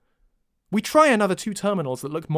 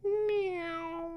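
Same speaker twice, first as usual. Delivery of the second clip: cat meow; 8 dB quieter than usual. Acted.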